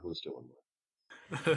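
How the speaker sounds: slow quiet echo. Real, not acted. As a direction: drop it low and slow. GM repeats the call.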